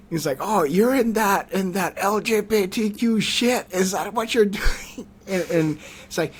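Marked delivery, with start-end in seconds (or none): 0.4-5.1 mock accusing. Hostile contact challenges him over his profile, mock accusing. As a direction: draw it out, pitched up, like a scornful accusation.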